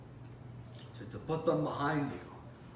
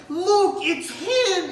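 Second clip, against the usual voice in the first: very high, loud alarmed yell; excited clerk; 11 dB louder, much slower than usual. Acted. Clerk pointing out the robber to the cop, high alarmed yell.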